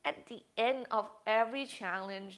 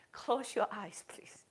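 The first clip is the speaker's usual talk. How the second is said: soft, hushed meditation voice with long pauses; guided meditation; 6 dB quieter than usual. Acted. Yoga teacher guiding the class through breathing, soft and hushed. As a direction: ease the class into calm breathing.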